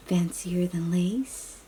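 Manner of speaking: plain reading voice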